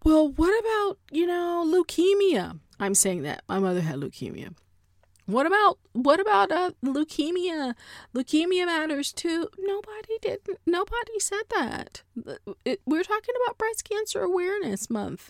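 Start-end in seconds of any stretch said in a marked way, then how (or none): 0.0-2.6 high-pitched mock interruption; mocking derailer, pitched way up; Karen. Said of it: "you hear Karen over in the corner". 5.3-9.5 high, insistent mock interruption; mocking derailer, pitched way up; Karen. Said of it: "you hear Karen over in the corner". 9.6-15.3 high-pitched exasperated correction; mock-exasperated, pitched way up; exasperated rebuttal. Exasperated rebuttal to the imagined Karen.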